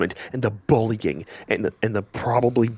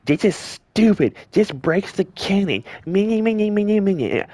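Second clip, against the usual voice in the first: whiny commenter mockery; 9 semitones higher than usual. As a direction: sneer it like a petty fan whining, ending in meh meh meh. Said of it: "You don't have to say".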